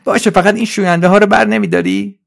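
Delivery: high, loud helper voice; Trolley. Acted. Trolley asks Suzi about the detergents, high and insistent. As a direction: ask it high and eager. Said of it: "ترولی گفت".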